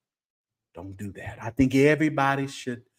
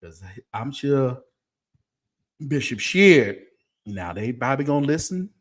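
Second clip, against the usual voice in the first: ordinary delivery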